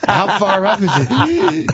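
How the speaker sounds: high, slow, mock out-of-breath; imitating wheezing. Quoting the wheezing hooker on the stairs, high and slow. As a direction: pant it out like climbing stairs. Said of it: "she's wheezing".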